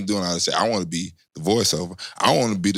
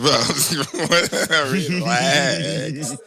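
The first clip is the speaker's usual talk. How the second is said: laughing through the quote; amused retelling; 6 semitones higher, much slower than usual. Retold or imitated. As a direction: chuckle out the words. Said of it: "I'm like".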